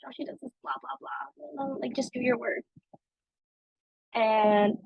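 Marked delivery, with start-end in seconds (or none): 0.0-2.6 much softer, dismissive mock grumble; hushed eye-rolling coworkers brushing off complaints. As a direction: brush her off like an annoyed coworker. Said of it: "But they're like".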